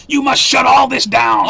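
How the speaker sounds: loud, drawn-out mock shout; mock outraged demand. Mimicking Congress demanding a shutdown, in mock outrage. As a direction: order it loudly and slowly, as a parody official.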